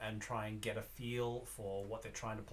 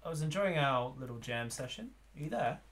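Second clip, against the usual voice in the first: raised, higher friendly hail; calling through a door; 5 semitones higher, 4 dB louder than usual. Acted. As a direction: call out politely to someone unseen. Calls to stranger through the door.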